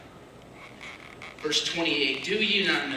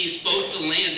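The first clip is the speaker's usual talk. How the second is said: ordinary talk, nothing marked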